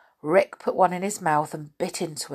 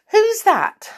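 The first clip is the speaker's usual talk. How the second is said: put-on much higher, loud old man's query; Saucepan Man asking; 9 dB louder than usual. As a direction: call out a startled who's that. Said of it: "he said".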